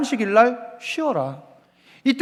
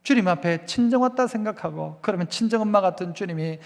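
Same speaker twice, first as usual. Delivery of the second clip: ordinary delivery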